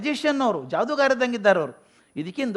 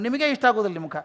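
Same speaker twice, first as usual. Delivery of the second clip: ordinary talk, nothing marked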